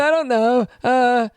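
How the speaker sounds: hesitant dim mock-boyfriend voice; dopey boyfriend imitation. Boyfriend asked about breaking up, dopey stammer. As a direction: hem and haw like a clueless boyfriend. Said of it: "this is how my boyfriend sounds to me".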